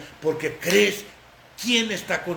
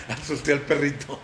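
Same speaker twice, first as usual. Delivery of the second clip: laughing; 5 semitones lower than usual. Real, not acted. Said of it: "asusté a Braulio".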